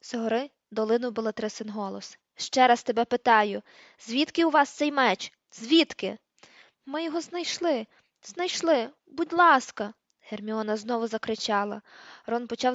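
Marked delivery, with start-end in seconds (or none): 2.4-6.2 loud, slow, forceful demand; angry interrogation. A narrator's reading. Bellatrix interrogating a captive, heard from upstairs. 6.9-9.9 high, distressed plea under questioning; desperate pleading. A narrator's reading. Tortured captive pleads with interrogator.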